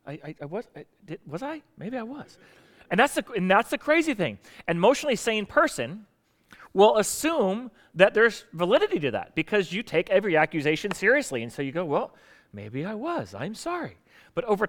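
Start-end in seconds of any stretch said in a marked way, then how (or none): 0.1-2.4 hesitant, much softer stammer; acting out someone unsure of themself; self-doubting. Imagined victim doubting themself. 11.8-14.0 soft, slow self-doubting concession; acted hesitant apology; meek apology. Imagined sane person meekly apologising.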